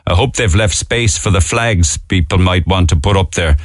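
ordinary delivery

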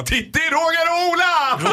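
high, loud, slow call of names; mock yell. Host pretending to yell at a gang, hammed up. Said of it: "ska jag springa och bara".

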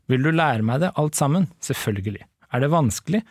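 measured read-aloud narration; story reading. Acted. Host reads the book's ending to co-host, story reading. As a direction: read the novel out clearly and evenly.

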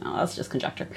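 unvoiced, dismissive whisper; whispered dismissal. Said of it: "And I was like".

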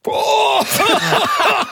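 high strangled laugh-shout; choking laugh-cry. Real, not acted. Host reacting to smelling salt, strangled laughing yelp. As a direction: burst out laughing as the salt hits.

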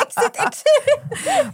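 very high, slow, laughing squeal; mock panic. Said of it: "jag bara".

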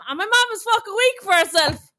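indignant, rising to a shriek on weak; outraged. Real, not acted. Telling her friend, outraged about mom. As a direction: voice shooting up on weak.

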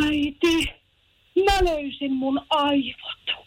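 put-on high, slow little child voice; small child. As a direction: mimic a proud three-year-old. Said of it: "se sanoi mulle silleen".

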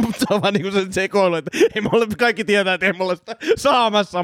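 high-pitched laughing voice. Real, not acted. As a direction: talk through your own giggles. Amused describing audition gone wild.

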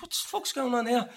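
high, startled retold swear; high-pitched mock disbelief; shocked outburst. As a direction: act out the shock of being cut off. Shocked as cop cuts him off.

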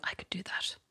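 hushed, dismissive under-the-breath remark; mother's whispered scoff. Retold or imitated. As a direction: whisper it like a sneer in a gallery. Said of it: "she just spent a lot of it going".

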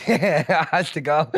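laughing through slow words. Real, not acted. Friend teased into an accent wants it cut, laughing. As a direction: dissolve into real laughter.

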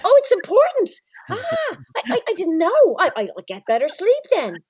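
high squeaky mock wide-eyed voice, loud at first; mock naive surprise. Host mocking sleep articles, high loud faux-naive squeak. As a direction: play dumb in a high, loud, gushing squeak. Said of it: "It's like".

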